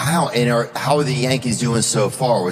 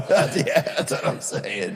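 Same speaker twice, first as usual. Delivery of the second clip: laughing; 7 semitones higher, slower than usual. Real, not acted. Laughing at friend's joke.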